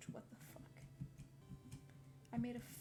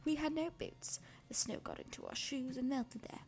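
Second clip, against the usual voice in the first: whispered reading; 2 semitones lower, 9 dB louder than usual. Real, not acted. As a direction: whisper the passage gently, like ASMR. Whispered reading of Jane Eyre.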